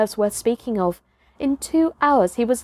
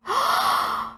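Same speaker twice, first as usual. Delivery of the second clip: gasp; 4 dB quieter than usual. A narrator's reading. Boy wakes in trampled clearing.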